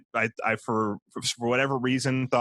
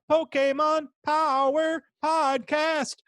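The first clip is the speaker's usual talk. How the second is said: high, slow, drawn-out shout; yelled show name; slower than usual. Pretend. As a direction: bellow the title like an announcer. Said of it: "where you don't yell".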